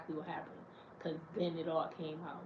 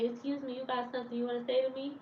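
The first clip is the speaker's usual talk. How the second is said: high, fast sassy challenge; confrontational challenge; 6 semitones higher, faster than usual. Retold or imitated. Woman calling out the guy in the chat, sassy and high. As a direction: call him out, fast and pitched up. She confronts him out loud.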